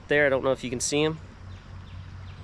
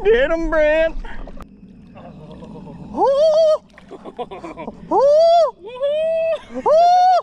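high loud cheers; excited cheering; 5 dB louder, much slower than usual. Real, not acted. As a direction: cheer on the catch. Cheering a catch.

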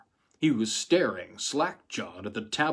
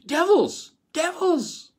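high, loud hysterical scream; 6 dB louder, slower than usual. A narrator's reading. Wife turns on the men around her, high hysterical screaming. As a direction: scream it at them as if cornered. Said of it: "she screamed".

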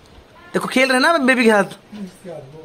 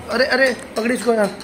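ordinary talk, nothing marked

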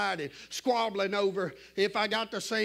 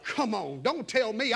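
normal talking voice

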